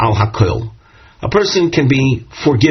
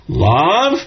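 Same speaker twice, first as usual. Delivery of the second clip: "Love," on a rising, astonished tone; wondering love; 4 semitones higher than usual. Shown as a demonstration. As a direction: let "Love," lift as if amazed. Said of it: "with a tone of wonderment".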